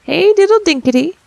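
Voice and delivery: high, loud squeaky mouse voices; little mice answering. A narrator's reading. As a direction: chirp it back like tiny mice. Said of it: "answered the little mice".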